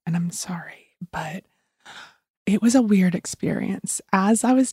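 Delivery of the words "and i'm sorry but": hushed whisper in the bathroom; whispering while hiding. Real, not acted. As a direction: whisper so nobody hears. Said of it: "I'm hiding in the bathroom right now recording this".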